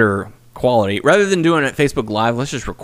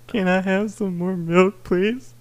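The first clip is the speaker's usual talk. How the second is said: begging whiny voice; 7 semitones higher, 4 dB quieter, slower than usual. Retold or imitated. Imitating Noah begging milk.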